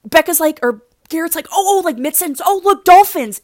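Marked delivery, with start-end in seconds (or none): 1.5-3.4 high, loud mock exclamation; excited sighting. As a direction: call out as if spotting something amazing. Acting Garrett spotting dolphins.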